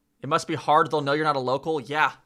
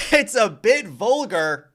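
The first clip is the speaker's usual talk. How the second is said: laughing; 6 semitones higher, 6 dB louder, slower than usual. Real, not acted. Laughs at rude word.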